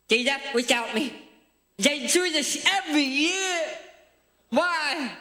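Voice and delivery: high, whiny sloth voice, sorry for himself; Sid the Sloth. Retold or imitated. Comedian doing the cartoon sloth for the crowd, high and whiny. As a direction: whine it like the lonely cartoon sloth. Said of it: "my Sid the Sloth impression".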